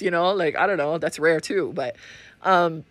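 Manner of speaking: ordinary delivery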